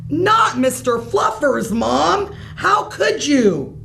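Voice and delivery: put-on a child's over-the-top protest, slowing at the end; high melodrama over a toy bunny; dramatic. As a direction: ham up the outrage. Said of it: "Don't be so dramatic".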